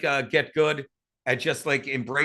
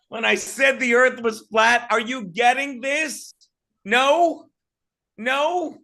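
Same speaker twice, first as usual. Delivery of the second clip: high, loud, playful probing; teasing mic check; 6 semitones higher, 4 dB louder, slower than usual. Real, not acted. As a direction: bait the audience. Testing if mic cut out.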